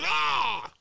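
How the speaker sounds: high yelp of horror; mock shriek. Brother reacting to Jordan Peterson, high mock shriek. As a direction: squeal like you touched a hot stove. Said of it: "Or Jordan Peterson".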